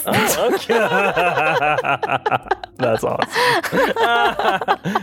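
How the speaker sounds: high squealing laugh through words; delighted high-pitched reaction. Real, not acted. Reacting to [name] pick.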